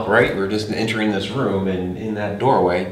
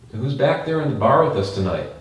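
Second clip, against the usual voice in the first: raised, higher questioning call; 5 semitones higher, 4 dB louder than usual. Real, not acted. Investigator asks who shares the bar tonight, calling out. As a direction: pitch it up toward the back room.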